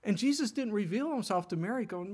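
usual speaking voice

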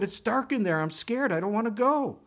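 put-on a small boy pleading he's afraid; scared little boy.

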